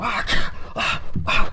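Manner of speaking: loud, rough mock grunts; imitated striking grunts. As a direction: act out repeated blows. Imitated grunts while smacking.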